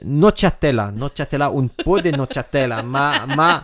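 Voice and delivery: hammed-up fake Italian rep; fake Italian accent. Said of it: "tu parles très bien le faux italien".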